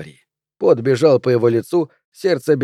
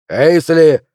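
loud call, falling at the end; shouted name; 3 semitones higher, 6 dB louder than usual. Acted. Flint shouting after Pelvis walking off, loud. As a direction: yell the name after him. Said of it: "закричал Флинт".

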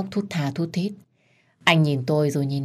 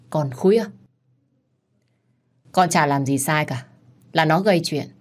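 usual speaking voice

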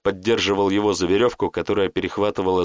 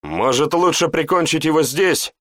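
exasperated; 7 semitones higher than usual. A narrator's reading. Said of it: "не выдержал Рамилио".

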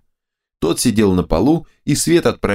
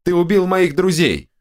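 angry accusation; 10 semitones higher than usual. A narrator's reading. Hero yells at ogre.

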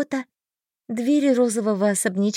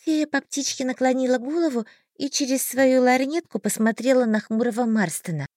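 ordinary delivery

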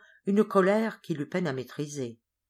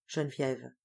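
ordinary delivery

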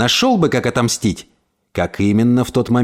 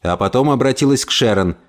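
usual speaking voice

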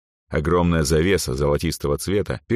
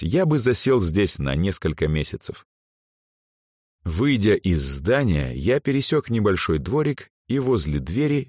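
plain reading voice

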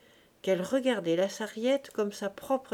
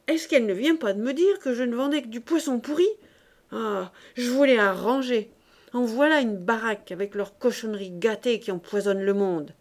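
loud, raised tirade, venting; indignant outburst; 3 semitones higher, 6 dB louder than usual. A narrator's reading. Fishwife ranting about the charcuterie, indignant outburst. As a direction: let the grievance pour out at full voice. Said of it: "s'écria-t-elle, heureuse de se soulager".

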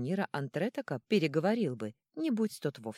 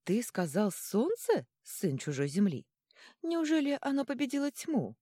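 put-on amazed old woman; 8 semitones higher, 3 dB louder than usual. A narrator's reading. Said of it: "изумилась старуха". (изумлённо, нараспев переспрашивая)

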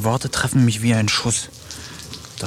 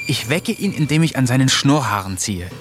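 normal talking voice

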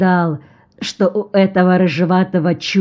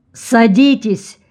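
slow, higher-pitched command with mild irritation; insistent, irritated order; 4 semitones higher, slower than usual. A narrator's reading. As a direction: order them to sit, a touch annoyed. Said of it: "настойчиво и раздражённо приказал капитан".

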